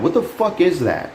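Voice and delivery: high, squeaky-astonished outburst; utterly baffled, voice shooting up; real shock at a live teddy bear; incredulous disbelief. Real, not acted. Reacting to bizarre trailer clip.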